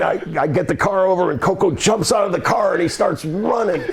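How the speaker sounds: ordinary talk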